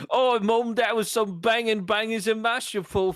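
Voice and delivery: high, loud Cockney lad voice; thick cartoon Cockney, ham it up; a Cockney son thanking his mum, hammed up. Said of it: "It's just more Cockney".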